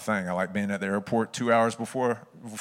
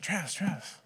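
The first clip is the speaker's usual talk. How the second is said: softer, higher imitation of the coach; gentle hushing; 8 semitones higher, 7 dB quieter than usual. Coach calming his crying player, gentle hushing. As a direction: softly cut off the babbling.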